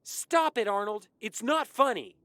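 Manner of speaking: high, loud frightened shout; upset, full-voiced outburst. A narrator's reading. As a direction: shout him down in a panic. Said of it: "I shouted".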